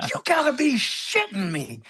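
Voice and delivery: retold stunned sergeant; incredulous disbelief. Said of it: "He's like".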